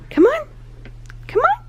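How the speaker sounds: high rising come on; sing-song coaxing. Real, not acted. Coaxing toddler to window.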